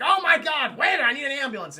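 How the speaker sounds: mock panic, high and loud; panicked cry. Acting out a bystander calling for an ambulance, panicked cry. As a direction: cry out for help in alarm. Acting out alarm at Harvey collapsing.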